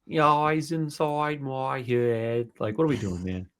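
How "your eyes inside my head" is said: put-on very slow, drawn-out pop-punk singer accent. Host mocking his own turn into Blink one eighty-two, exaggerated singer impression. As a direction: stretch every vowel in a mock singer voice. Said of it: "[name] also has a British accent now".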